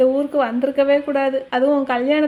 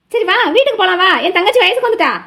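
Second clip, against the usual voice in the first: higher, louder eager summons; loud, excited; 5 semitones higher, 6 dB louder, faster than usual. Acted. Woman urging Mayilu home with the news, loud and excited. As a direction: hurry her home with big news.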